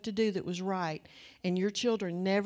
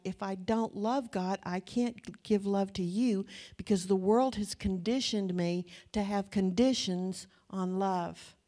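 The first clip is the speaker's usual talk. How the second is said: ordinary delivery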